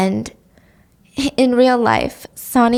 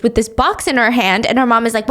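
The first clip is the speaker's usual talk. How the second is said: normal talking voice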